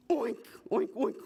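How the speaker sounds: unvoiced-sounding snorted oinks, whispery; short breath-only imitation pig grunts. A narrator's reading. Little bird crying out the wrong call, whispery oinking. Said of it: "The little bird cried out".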